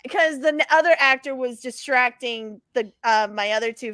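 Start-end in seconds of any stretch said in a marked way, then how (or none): none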